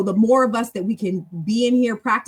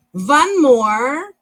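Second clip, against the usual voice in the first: higher and louder, lilting up at the end; playful announcement; 5 semitones higher, 7 dB louder than usual. Real, not acted. Teacher announcing one more round to the class, playfully emphatic. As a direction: sing out ONE MORE with a rising lilt.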